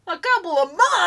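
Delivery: high, loud disbelief; shocked. Real, not acted. Repeats it higher and louder.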